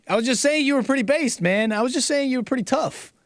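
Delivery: high, quick defensive protest; mock protest. Host mock-protests to Jonah Hill that he meant praise, high and defensive.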